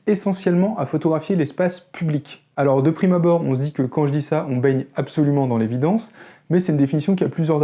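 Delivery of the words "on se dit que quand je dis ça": ordinary delivery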